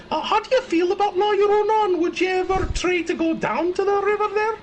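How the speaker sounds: high, lilting Irish elf voice, pitched well up; Irish elf accent. Pretend. Irish elf accent, asking the guest about La Llorona. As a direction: play a cheeky Irish elf. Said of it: "do the rest of the podcast with an Irish elf accent".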